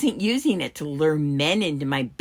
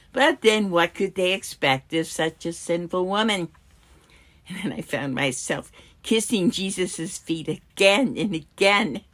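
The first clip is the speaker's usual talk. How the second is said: ordinary delivery, nothing marked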